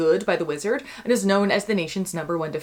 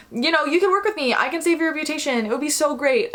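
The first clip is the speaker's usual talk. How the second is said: mock coaxing wizard; 8 semitones higher than usual. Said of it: "who is like".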